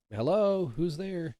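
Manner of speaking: high, loud call to someone unseen. Retold or imitated. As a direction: call through the door, pitched well up. Calling out to unseen knocker.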